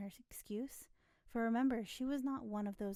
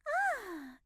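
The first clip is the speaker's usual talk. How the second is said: relieved ah; 13 semitones higher, 6 dB louder than usual. A narrator's reading. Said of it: "evidently relieved".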